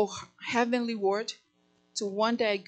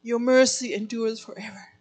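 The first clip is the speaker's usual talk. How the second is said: emotional; 4 dB louder than usual. Real, not acted. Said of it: "I get emotional when I speak about Jesus".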